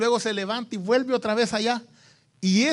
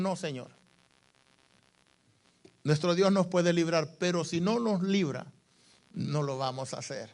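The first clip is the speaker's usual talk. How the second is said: hushed, low reply, fading softer to the end; quiet, steadfast refusal; 4 semitones lower, 8 dB quieter than usual. Acted. Three servants refuse the king, quiet and steadfast. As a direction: refuse softly and calmly, sinking very low.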